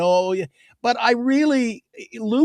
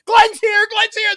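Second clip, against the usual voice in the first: very high mock-female squeal; imitated excited women; 3 dB louder, slower than usual. Retelling women rushing over to Glenn, imitated excited squeal. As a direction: pitch it way up as gushing fans. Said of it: "remember the women were rushing over".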